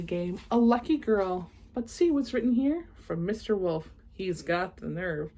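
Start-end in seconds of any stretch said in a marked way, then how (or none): none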